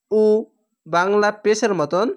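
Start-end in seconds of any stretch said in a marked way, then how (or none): 0.1-0.2 উ with lips pushed round. Shown as a demonstration. Teacher drilling pupils, rounded উ. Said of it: "গোল ঠোঁট হয়ে".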